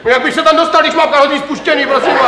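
high, exasperated comeback; worked-up retort. Acted. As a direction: protest indignantly at a useless suggestion. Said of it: "Já se vždycky tak rozčílím, když tohleto vyprávím".

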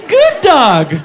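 high giggle then squeaky thanks; doughboy giggle. Acted. Doughboy turning back to the camera, a high giggle. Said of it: "he goes".

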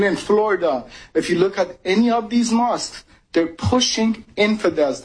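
normal talking voice

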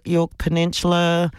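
ordinary talk

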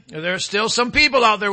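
ordinary talk

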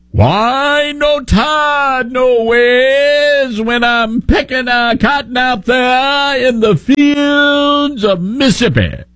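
high, very slow mock southern accent; mock Hillary Clinton southern accent. Host mocking Hillary's fake southern church voice, slow Southern drawl. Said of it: "And that's Hillary Clinton".

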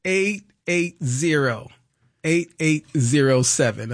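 slowed down, each digit spaced out; slow and clear. Shown as a demonstration. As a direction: read the number back slowly and carefully. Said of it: "if I said it too fast in my New York accent".

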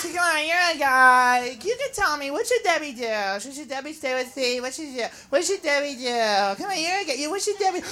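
soft, wheedling repetition; hushed, nagging plea; pestering girlfriend's friend. Acted. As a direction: coax advice out of a guy. Girl pesters a guy for advice.

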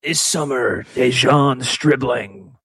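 deep, slow trailer narrator; movie trailer voice. Acted. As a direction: announce it like an epic film. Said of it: "I just wanted to do the movie guy voice".

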